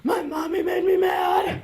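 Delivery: put-on a little boy crying out a grievance; sulky kid shout. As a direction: son telling mom's friend why he is upset. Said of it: "He's like".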